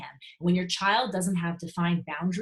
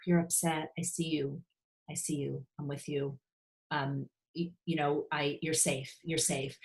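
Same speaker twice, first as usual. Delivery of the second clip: soothing parent; 3 semitones lower, 6 dB quieter than usual. Acted. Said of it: "as opposed to saying".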